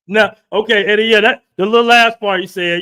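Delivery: laughing reaction. Real, not acted. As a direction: burst out laughing at the story.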